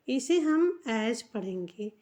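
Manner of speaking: normal talking voice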